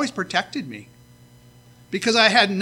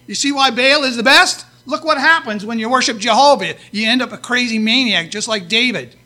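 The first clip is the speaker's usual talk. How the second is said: loud, raised mocking taunt; mocking Philistines; 5 semitones higher, 11 dB louder than usual. Preacher voicing Philistines mocking loudly. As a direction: jeer at David's God loudly.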